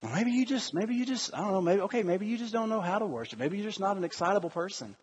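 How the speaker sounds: soft, halting, let-down tone; resigned. Acted. God excusing an unexcited worshiper, soft and resigned. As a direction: trail through excuses quietly, giving up.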